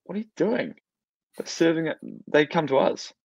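mock the bride's alarm, high and quick; imitating the bride. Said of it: "And then the bride was like".

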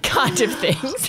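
ordinary talk

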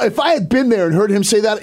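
ordinary talk, nothing marked

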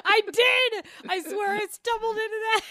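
high protest through real laughter; laughing hard. Real, not acted. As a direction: plead innocence while cracking up. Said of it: "That's why I'm laughing so hard".

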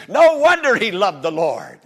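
loud, ringing exclamation; preaching shout. Real, not acted. Preacher to congregation, bursting into a loud shout. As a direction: lift the line to a shout of praise.

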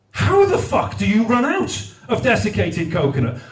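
ordinary talk

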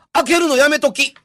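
high sharp warning cry; urgent warning shout. Retold or imitated. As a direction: stop the child sharply. Mother warns child urgently.

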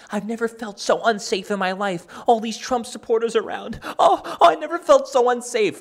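high, whiny mock panic, rising to a squeal on "Oh, oh"; mocking a panicked woman. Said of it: "And she's, like, crying".

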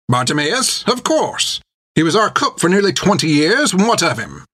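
put-on high, tart old nun's voice, unimpressed; old nun answering the pirate with venom. A narrator's reading. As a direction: sound like a sharp old sister refusing to be cowed. Hilda voiced in the story.

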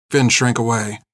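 ordinary delivery, nothing marked

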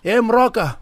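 put-on loud, high Zulu-accented greeting. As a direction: call it out in a thick Zulu accent. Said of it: "in the most Zulu of, Zulu accent".

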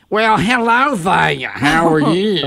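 slow, put-on deep woman's voice; slow granny-like deep girl greeting. Shown as a demonstration. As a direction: ham up a deep girl voice, drawn out. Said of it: "I'll do my deep girl voice".

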